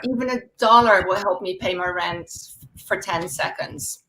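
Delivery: normal talking voice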